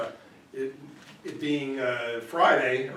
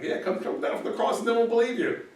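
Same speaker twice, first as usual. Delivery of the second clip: high, scornful jeer; mocking taunt; 10 semitones higher than usual. Another mocker jeering at the crucified Jesus, high scorn. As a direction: goad him with sneering disbelief.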